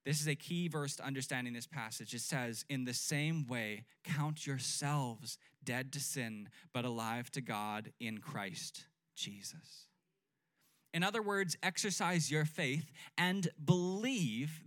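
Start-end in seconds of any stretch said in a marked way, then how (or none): 2.7-9.9 slow scripture reading. Real, not acted. Pastor reads key verse slowly.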